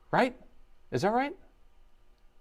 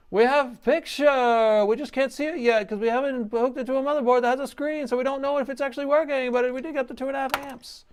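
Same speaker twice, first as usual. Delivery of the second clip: much higher, giddy and wound up; a little bit crazy; 13 semitones higher than usual. Real, not acted. To viewers as the repaired board powers on, giddy and much higher. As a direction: let the excitement run away with you. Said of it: "I'm being a little bit crazy".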